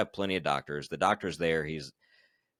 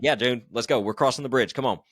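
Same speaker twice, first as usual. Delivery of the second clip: higher and louder, pumped-up urging; fired-up rally; 6 semitones higher, 6 dB louder than usual. Retold or imitated. Rallying his pacer back onto the course, pumped-up and loud. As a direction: fire up your buddy to head out.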